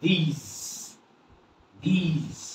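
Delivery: "these" with the final E left silent. Shown as a demonstration. Teacher models the word for learners, "these" with silent final E. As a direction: say "these" as one long syllable, no end vowel. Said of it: "এই vowel উচ্চারণ করব না".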